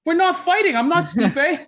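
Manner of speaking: mock exasperated protest. Retold panicked protest to sparring coach.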